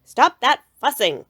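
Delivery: Papa stopping the fuss, loud stern order. A narrator's reading. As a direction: put your foot down firmly. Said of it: "in his loudest Papa Bear voice".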